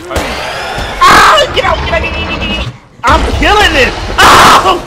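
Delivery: frantic high, loud yelling; real panicked shrieks during the fight; screaming. Real, not acted. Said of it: "killing you guys' eardrums".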